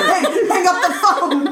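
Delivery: high, loud mock shout; urgent yell. Officer to colleague hogging the line, urgent yell. Acting yelling at phone user.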